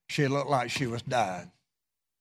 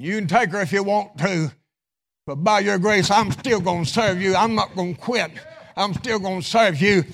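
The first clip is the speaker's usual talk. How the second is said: raised, ringing sermon delivery; full-throated pulpit exhortation; impassioned preaching; 7 semitones higher, 6 dB louder than usual. Real, not acted. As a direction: preach it with rising fervor.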